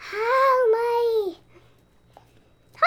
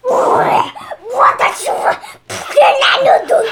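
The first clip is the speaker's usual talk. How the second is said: pterodactyl voice; 9 semitones higher, 9 dB louder, slower than usual. Pretend. Child playing pterodactyl announces itself.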